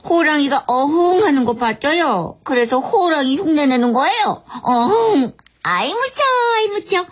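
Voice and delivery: exaggerated teacher soothing a toddler; baby talk. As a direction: coo and mock-scare as if to a small child. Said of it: "돌아오는 건 애기 취급이었거든요".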